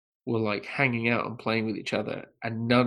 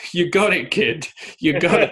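louder, higher, choked-up praise; moved director; 5 semitones higher, 5 dB louder than usual. Retold or imitated. Podcaster voicing Spielberg moved after the audition. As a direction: tell the kid he nailed it. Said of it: "Spielberg just goes".

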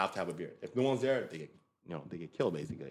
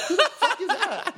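shocked; 6 dB louder, slower than usual. Retold or imitated. Shocked retold reaction.

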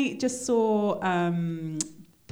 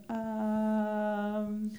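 long, quiet acted "um"; stumped hesitation; 8 dB quieter than usual. Host imitating actor caught faking Swahili, stumped and hesitant. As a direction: draw it out softly, caught out.

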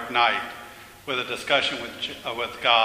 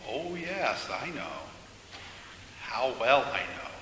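hushed, sorrowful knowing; soft reply; 7 dB quieter than usual. A narrator's reading. God admitting knowledge of the man's suffering, softly. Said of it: "then God said softly".

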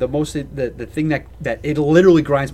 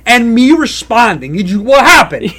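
exasperated rant; 6 semitones higher, 5 dB louder than usual. Acted. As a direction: demand an answer, incredulous and worked up. Acted rant at silent parent.

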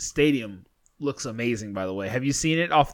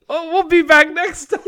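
giggling through the words; laughing while signing off; 13 semitones higher, 5 dB louder than usual. Real, not acted.

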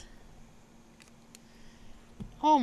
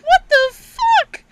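very high, loud, drawn-out disbelief; hammed-up incredulous cry, stretched slowly; flabbergasted; 9 dB louder, much slower than usual. Child reacting to dad's confession, flabbergasted.

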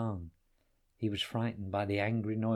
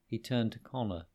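plain reading voice

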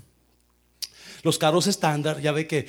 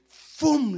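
whoosh; 11 semitones higher, 4 dB louder than usual. Retold or imitated. Car drops off driveway.

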